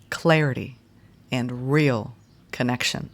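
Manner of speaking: phrase by phrase, long pauses between; deliberate declaration, spaced out for repeating; slow, for writing down. Real, not acted. Reader dictating a declaration for listeners to write down, said slowly. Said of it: "I'll say this slowly".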